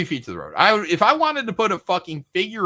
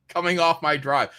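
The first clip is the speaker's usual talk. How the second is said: normal talking voice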